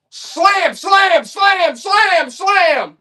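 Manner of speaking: rapid-fire high, loud repeats; hype shouting. Acted. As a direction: shout each word high, falling like a hammer blow. Seller chants slam in hype.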